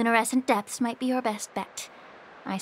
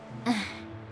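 quiet, breathy exhale; short sigh; 7 dB quieter than usual. Acted. Healer worried about a fighter's injuries, short sigh. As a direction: let out a small sigh.

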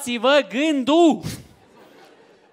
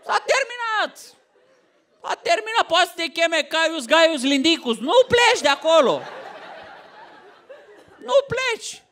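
shouted ultimatum; 7 semitones higher than usual. Acted. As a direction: slam the door on him. Wife forbids Roman from leaving.

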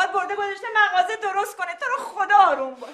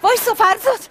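calling out; 6 semitones higher, 5 dB louder than usual. Acted. Mother calls after leaving son.

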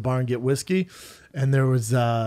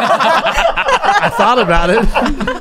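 high, loud, slowed by laughter; cracking up mid-sentence; laughing; 4 semitones higher, 7 dB louder, slower than usual. Real, not acted. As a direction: talk through a big laugh. Cracking up at friend's question.

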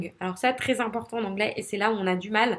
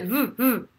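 short revving R bursts; little motorbike revs. Shown as a demonstration. Teacher showing learners the motorbike trick, short revs. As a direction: give quick revs on the R. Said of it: "une petite moto".

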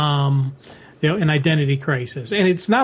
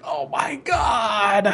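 long, drawn-out mock cry; slow, exclaiming wail of awe; overwhelmed reaction; 3 semitones higher, much slower than usual. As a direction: stretch it out slowly, overcome. Acts out overwhelmed reader reaction.

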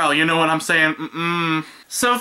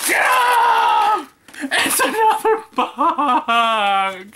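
high shout sinking into a long drawn-out wail; mock wail; 8 semitones higher, much slower than usual. Greeting yet another box, mock wail. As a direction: stretch it out in theatrical despair.

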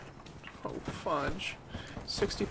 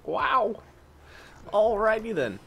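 high, loud, excited outburst; excited cheer; 11 semitones higher, 7 dB louder, slower than usual. Real, not acted. Gamer cheering after a wild fight, high and loud. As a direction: burst out high and loud with glee. Reacting to a round.

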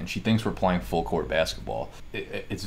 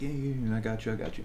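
higher, lightly voiced eager-to-please tenant; mock reassuring; 4 semitones higher, 3 dB quieter than usual. Tenant sweet-talking the landlord, lightly mocked eager voice. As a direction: play the agreeable neighbour, a bit higher.

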